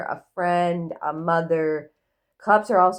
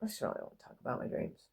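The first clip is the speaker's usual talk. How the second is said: whisper; 6 semitones lower, 16 dB quieter, much faster than usual. Real, not acted. Aside to herself.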